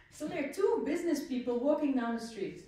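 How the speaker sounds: usual speaking voice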